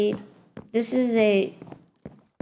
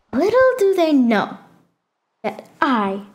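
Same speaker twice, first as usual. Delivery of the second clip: dramatic reveal; slower than usual. Pretend. Player lets viewers in on the hidden base, mock-dramatic reveal. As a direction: build mock suspense and stretch the reveal.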